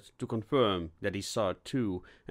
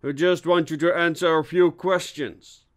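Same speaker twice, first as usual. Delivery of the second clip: raised, higher voice carrying across a room; calling out; 5 semitones higher, 6 dB louder than usual. Acted. Inspector to hidden intruder in the attic, calling out. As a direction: call to someone out of sight.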